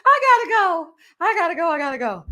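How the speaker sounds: can't stop laughing while signing off; laughing hard. Real, not acted. Vlogger cracking up over the show, laughing.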